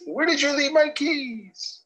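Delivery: high, slow question; high pitch. Shown as a demonstration. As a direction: lift the pitch way up.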